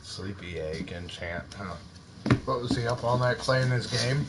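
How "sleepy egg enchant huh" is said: low slow hush so he stays asleep; soft and slow, almost a murmur. Real, not acted. Son asleep nearby.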